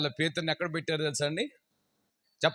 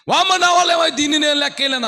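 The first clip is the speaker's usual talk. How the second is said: high, loud exclamation of awe; astonished cry; 11 semitones higher, 7 dB louder, faster than usual. Acted. Abraham told to count the sand, astonished. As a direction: gasp it out in disbelief.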